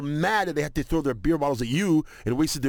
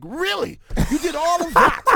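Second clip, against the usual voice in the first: high, incredulous retort, building louder; mock incredulity; 14 semitones higher, 6 dB louder than usual. Players to a man who made a speech just to pass, incredulous. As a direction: act out exasperated disbelief. Acted reaction to speech before passing.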